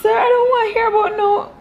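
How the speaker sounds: hammed-up shocked objection; squealed outraged retort; mock outraged. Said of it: "I was just like".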